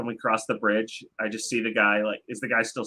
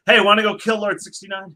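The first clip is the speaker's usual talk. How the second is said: high, loud eager pitch; hasty, impatient blurt; 7 semitones higher, 7 dB louder than usual. Acted. Impatient adventurer to hooded stranger, hasty blurt. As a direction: blurt it out impatiently, words tumbling. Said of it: "I say it so fast, I stumble over the words".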